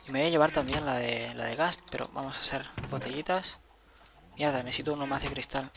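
ordinary delivery

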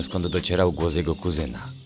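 usual speaking voice

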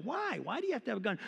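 high-pitched, baffled why; squeaky acted bewilderment; incredulous questioning. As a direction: question them in exaggerated disbelief. Acting his baffled reply to them.

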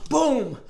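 high gasp then a boom. Retold or imitated. Haymaker on the choker, gasp then boom. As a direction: wind up and hit it.

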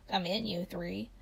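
hushed, slow eerie beckoning; creepy voice. A narrator's reading. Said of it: "in a creepy voice".

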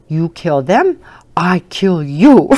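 put-on glare and threaten him like a tough little girl; fierce little girl threat. Granddaughter threatening her dad over the mice, loud mock menace. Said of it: "she looks straight at him and she goes".

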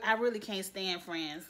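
normal talking voice

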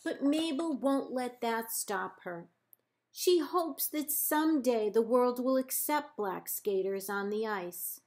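plain reading voice

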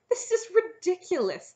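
high, loud outburst of disbelief; scandalised objection; indignant dragon. A narrator's reading. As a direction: protest loudly, pitched right up. Dragon protests the princess's offer.

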